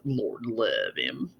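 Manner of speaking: low, slow, soft mock pity; sarcastic. Pitying a moron behind his back, sarcastic. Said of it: "in sarcasm".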